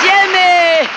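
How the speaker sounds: loud high call of his name. Acted. Star hailing her co-star with a loud call. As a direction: sing out his name across the stage. Tallulah calling out to Jimmy.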